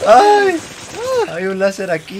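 high, loud panicked cry; panicking gamer. Real, not acted. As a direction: cry out, caught in a trap. Trapped by a laser in game.

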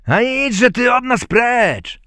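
loud, high, drawn-out dismissal; angry thieves. A narrator's reading. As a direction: shout the pest away. Thieves chase the little man away. (gniewny, głośny okrzyk złodziei)

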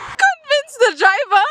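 reads through bursts of laughter; cracking up mid-sentence; laughing. Real, not acted. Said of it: "I'm not even finished reading".

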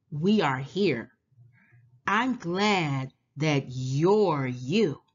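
plain reading voice

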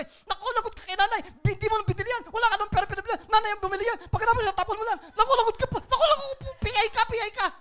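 hammed-up scolding, high and loud; Sister Opel, angry. Preacher imitating sister angry over discarded medicine, loud high scolding. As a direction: mimic a furious sister's high-pitched tirade. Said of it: "Galit na galit si Sister Opel sa akin".

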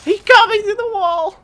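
shrieking high and loud; outraged yell. Real, not acted. Killed through wall.

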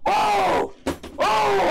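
high, loud "oh" of frustration; pissed-off yell. Real, not acted. Streamer getting pissed at chat, yelling. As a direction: yell "oh" in sheer exasperation. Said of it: "I'm getting pissed".

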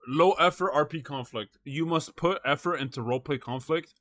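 normal talking voice

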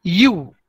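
यू as one rounded, gliding "yoo"; twisted U sound. Shown as a demonstration. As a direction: isolate the twisted vowel in यू. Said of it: "यू को twist किया". (यू की twist की हुई ध्वनि)

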